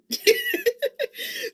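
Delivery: loud high laugh bursting out; laughing hard, high and loud. Real, not acted. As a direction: crack up at the punchline. Mother laughs retelling Apple Pay.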